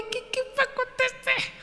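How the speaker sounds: very high, drawn-out squeal; comic falsetto of helpless fright; mock panic. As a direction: squeak it out slowly like a terrified passenger. Retold fright inside a cloud.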